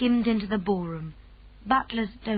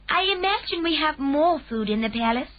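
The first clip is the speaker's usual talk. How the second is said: a lightly voiced calm Queen, higher; gracious royal command, a touch higher; 7 semitones higher, 4 dB louder than usual. A narrator's reading. Queen speaks.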